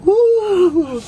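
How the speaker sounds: high delighted woo; excited whoop. Real, not acted. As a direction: cheer it out high. Reacting to the cold drink.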